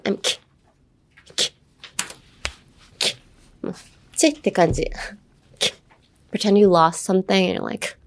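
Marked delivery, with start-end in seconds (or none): 0.0-3.2 "ki-" as a clipped, voiceless click of breath; throat K. Shown as a demonstration. Teacher isolating the start of kidding, throat K. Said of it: "the sound you would make with your throat". 5.6-5.7 "Ki-" as a short voiceless throat sound. Shown as a demonstration. Teacher showing the sound for kidding, throat K. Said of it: "the sound you would make with your throat".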